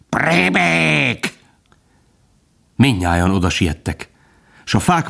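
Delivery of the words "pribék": high, long-drawn screech; cawing crows. A narrator's reading. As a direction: stretch the insult into a crow's cry. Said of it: "ezt rikácsolták a varjak". (varjúként rikácsolva)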